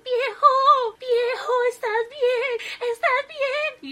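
high, loud desperate calls; a wife crying out to her fallen husband; shrieking wife voice, frantic; frantic calling. A narrator's reading.

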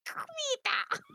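mock conniption, very high strained squawk; Donald. Podcaster acting out Donald's fit, mock conniption. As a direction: imitate a cartoon duck throwing a fit. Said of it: "Donald's having a conniption about it".

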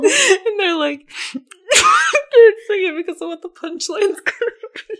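laughing. Real, not acted. Laughing while quoting audio.

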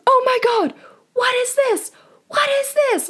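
very high-pitched mock hysterics; freaking out. Dad who found the condom going crazy, high hammed-up freak-out. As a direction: shriek it in a panicked, squeaky imitation. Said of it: "so he starts freaking out".

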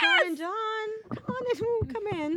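high lilting welcome voice; sing-song host. Pretend. Greeting the three Dons in a high, camp sing-song. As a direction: camp hostess welcoming guests in.

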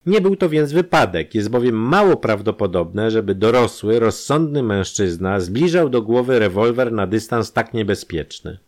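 lecturing doctor. A narrator's reading. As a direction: lay out the proof point by point. Doctor explains the evidence.

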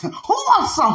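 high-pitched, hammed-up challenge; Debo. Speaker imitating a street bully, mock challenge. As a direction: goad everyone to fight, in mock bravado. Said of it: "Many of us like Debo out here".

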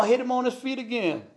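plain reading voice

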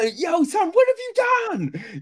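high, loud disbelieving yell; incredulous shout. Retold or imitated. Voicing a friend's disbelief.

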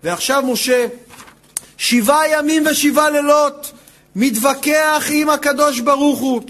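normal talking voice

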